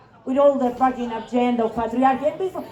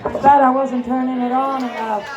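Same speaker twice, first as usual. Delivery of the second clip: normal talking voice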